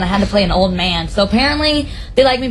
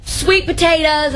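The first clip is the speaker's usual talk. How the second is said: high, slow Southern drawl; Southern grandma; 7 semitones higher, slower than usual. Acted. Guest imitates own grandma, Southern grandma drawl. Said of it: "my grandma's so Southern".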